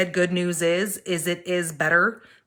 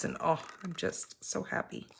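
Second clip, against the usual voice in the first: breathy whisper; 13 dB quieter than usual. Real, not acted. Halloween shows delight.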